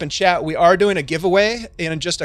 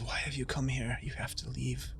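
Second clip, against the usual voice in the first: much softer, urgent near-whisper; hushed warning; 13 dB quieter than usual. Acted. Prisoner urges the party to leave, hushed and much softer. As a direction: press them to go, barely voiced.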